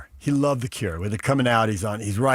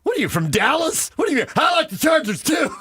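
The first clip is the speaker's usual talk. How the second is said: shocked disbelief. Retold or imitated. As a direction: act stunned he's a Charger fan. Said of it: "I'm like".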